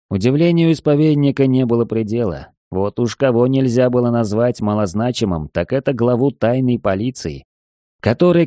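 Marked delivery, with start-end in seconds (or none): none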